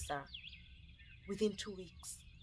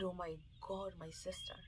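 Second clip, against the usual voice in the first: hushed dismay; 10 dB quieter than usual. Retold or imitated. Seeing dust, fearing for sister.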